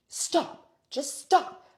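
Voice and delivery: loud, high imitated shout; acted-out shout to back off; exasperated yell. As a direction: yell it as a desperate order. Acted man yelling stop.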